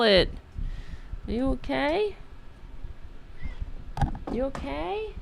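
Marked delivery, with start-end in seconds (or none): 1.3-5.1 high, softer cooing question; soft high concern for the cat. Real, not acted. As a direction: gently coo a worried are-you-okay. Checking on the cat.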